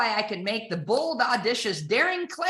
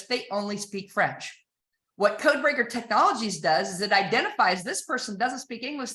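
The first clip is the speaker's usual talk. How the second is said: ordinary delivery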